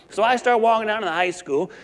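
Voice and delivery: ordinary talk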